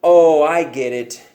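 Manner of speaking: loud, sulky accusation; accusing pigeon. Acted. Pigeon accuses the reader, loud and sulky. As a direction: hurl it like a wounded bird.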